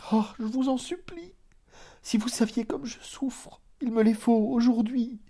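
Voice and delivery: very high, breaking, half-whispered plea; tearful supplication. A narrator's reading. Hypnotised cousin implores narrator, high tearful whisper. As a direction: beg through tears, almost voiceless. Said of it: "Elle pleurait et bégayait".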